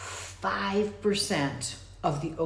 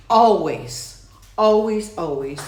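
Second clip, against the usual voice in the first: emphatic; 8 dB louder, slower than usual. Real, not acted. Emphatic repetition.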